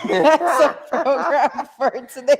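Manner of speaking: laughing through the sign-off. Real, not acted. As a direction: chuckle out the words. Laughing while wrapping up show.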